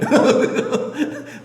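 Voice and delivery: breathy, high-pitched giggle; amused laugh. Real, not acted. Preacher joking about who rules the home, amused laugh. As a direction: break into a long chuckle.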